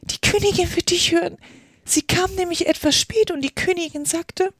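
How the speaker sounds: high-pitched, alarmed rabbit; frightened rabbit. A narrator's reading. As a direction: warn her in a high, scared voice. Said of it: "in sehr erschreckendem Tone". (erschrockenes Kaninchen, hohe Stimme)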